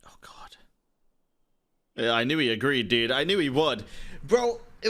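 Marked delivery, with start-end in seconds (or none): 0.1-0.6 breathless whispered dismay; real hushed disbelief; shocked whisper. Real, not acted. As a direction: barely voice it, stunned. Stunned by plot twist.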